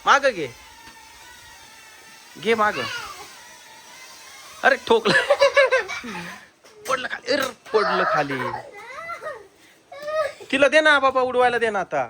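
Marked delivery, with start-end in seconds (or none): 5.2-6.3 high burst of laughter. Real, not acted. Father laughs at plane crash.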